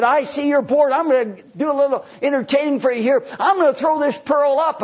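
a cocky performer working the crowd; boastful showman. Acted.

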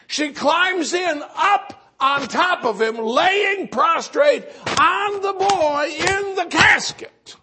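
high, loud, slow dramatic storytelling; full-force preaching. Acted. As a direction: drive each phrase out slowly in a preacher's shout.

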